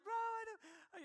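high, slow, crying protest; a sobbing kid to his laughing brother. Retold or imitated. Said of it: "I'm just crying".

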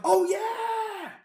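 very high, loud, held-out cheer; ecstatic shout. Retold or imitated. Fan learning his hero is in the film, ecstatic shout. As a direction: whoop it, stretching the last word. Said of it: "And I was like".

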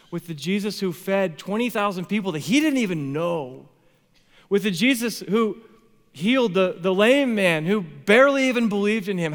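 increasingly heated questioning; building protest. Acted. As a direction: build from hurt to loud challenge. Protest building over unanswered prayer.

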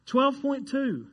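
high, slow disbelief; amazed repeat. Real, not acted. Preacher repeats the record for the congregation, amazed. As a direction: draw each word out high in wonder.

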